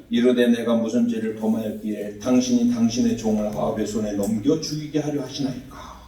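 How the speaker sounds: low, soft monotone scripture reading; emotionless wrong reading. Shown as a demonstration. Preacher shows how not to read the verse, lifeless monotone. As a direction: read it in a droning low voice without feeling. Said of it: "이렇게 읽으면 안 된다는 거예요".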